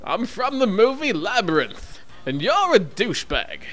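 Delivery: high, lightly done Bowie voice, laughing through it; [name]. Pretend. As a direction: hand the prank call over as Bowie. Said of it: "This is [name]".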